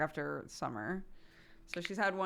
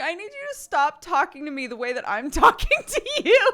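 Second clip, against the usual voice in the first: high, loud mock tantrum; upset little kid; 10 semitones higher, 12 dB louder than usual. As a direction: scold like an indignant small child. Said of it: "like, a little kid".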